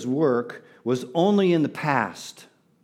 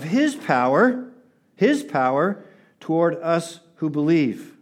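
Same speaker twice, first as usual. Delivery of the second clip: measured, raised reading voice with long pauses; slow scripture reading; 3 semitones higher than usual. Real, not acted. Pastor reading Ephesians to the congregation, slow and solemn scripture reading. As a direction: read it out phrase by phrase, weighting key words. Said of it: "read it a little bit slowly".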